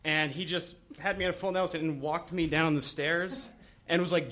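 usual speaking voice

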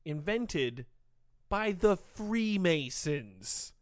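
slow conspiracy reveal. Pretend.

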